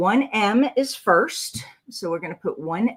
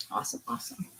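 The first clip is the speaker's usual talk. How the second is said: breathy whisper to herself; hushed whisper; whispered aside; 15 dB quieter, much faster than usual. Real, not acted. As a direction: whisper it under your breath.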